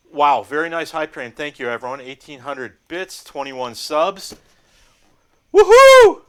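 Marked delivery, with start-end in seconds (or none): 5.5-6.2 very high, loud woohoo; excited whoop. Real, not acted. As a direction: celebrate at the top of your lungs. Cheering hype train result.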